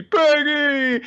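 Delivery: high, drawn-out hammed-up wail; mock anguished cry. Bear crying over a fallen comrade, mock anguish. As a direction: wail the name in cartoon grief. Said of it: "the bear's crying over Private Peggy".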